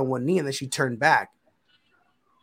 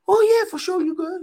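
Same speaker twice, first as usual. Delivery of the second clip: eager agreement; 5 dB louder than usual. Retold or imitated. Father happily giving his blessing, eager agreement. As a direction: imitate an easygoing yes, pitched way up.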